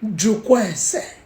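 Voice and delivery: Jukwa ese said slow and even; the wise way, no shouting, just slow calm words; calm, not shouting. Shown as a demonstration. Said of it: "No be by shouting".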